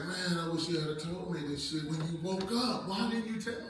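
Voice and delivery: soft, grave reproach; mock serious. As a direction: feign deep concern to prank your son. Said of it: "got real serious".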